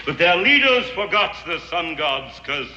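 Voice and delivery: normal talking voice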